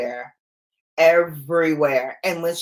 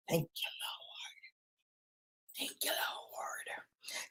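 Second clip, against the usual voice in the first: slow breathy whisper, much softer; whispered thanks; 21 dB quieter, much slower than usual. Real, not acted. Mom grateful her grown kids left home, slow whisper. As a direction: whisper it slowly like a relieved prayer.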